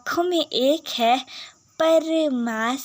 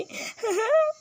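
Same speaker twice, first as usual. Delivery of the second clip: high squealing giggle; 10 semitones higher than usual. Acted. Bride giggling to her mother-in-law. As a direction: squeal a laugh.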